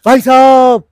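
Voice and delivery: loud high call of bhai sahab as Fardeen; hammed-up Fardeen Khan shout. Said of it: "तो फरदीन खान".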